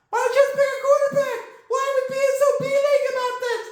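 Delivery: high puppet crocodile voice, disgusted; squeaky cartoon crocodile griping about the quarterbacks; high-pitched puppet croc, getting worked up; Corky the Crocodile. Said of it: "Corky the Crocodile".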